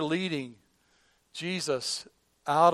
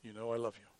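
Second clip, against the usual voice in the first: soft, lowered, unadorned answer; simple, humble; 4 semitones lower, 11 dB quieter than usual. Retold or imitated. Peter answering Jesus by the campfire, simply. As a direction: say it quietly and simply, no bragging. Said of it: "He very simply says".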